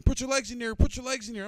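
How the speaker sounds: loud high screamed order; screaming instructor. Retold or imitated. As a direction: yell the command over the wind. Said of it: "He's screaming at me".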